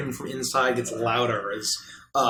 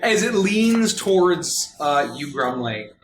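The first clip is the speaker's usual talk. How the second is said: normal talking voice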